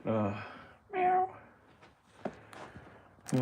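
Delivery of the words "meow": squeaky meow; high cat meow. Pretend. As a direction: mew like a cat.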